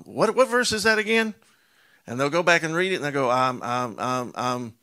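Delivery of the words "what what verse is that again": higher, louder confused question; mock flustered pastor. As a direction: play the pastor scrambling for the verse. Pastor fumbling for the verse.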